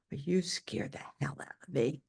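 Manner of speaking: a hint of a grumpy doctor; grumbling doctor. Doctor scolding his patient at the next visit, gruff.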